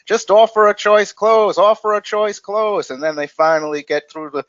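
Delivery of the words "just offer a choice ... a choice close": higher, louder pleading to himself; exasperated inner voice. Retold or imitated. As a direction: urge the rep in your head, exasperated. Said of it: "I'm just saying to myself".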